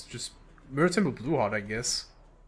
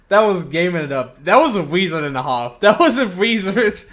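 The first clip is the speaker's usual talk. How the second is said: hyped; 3 semitones higher, 7 dB louder than usual. Real, not acted. Hyped about the play.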